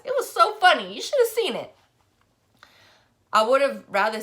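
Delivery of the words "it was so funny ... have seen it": much higher and louder, bursting with glee; gleeful gossip. Acted. Kenya bragging to Starr about hitting Denasia, gleeful. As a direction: crow about it like the best news all week.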